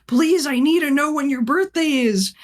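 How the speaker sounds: high-pitched, pleading mock panic; imitated breakdown. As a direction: plead like a student melting down. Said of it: "having, like, a fucking breakdown".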